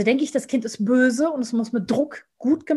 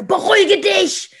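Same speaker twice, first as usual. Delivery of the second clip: high, loud, slow snap; tense command; 10 semitones higher, 9 dB louder, slower than usual. Shown as a demonstration. Parent orders the child to calm down, loud and tense. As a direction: bark it out tense. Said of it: "wenn ich sage".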